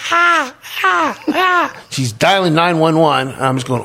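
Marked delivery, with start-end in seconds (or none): 0.1-1.8 high mock shrieks of "ah"; imitated screaming. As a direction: squeal like a panicked girlfriend in the corner. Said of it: "just screaming".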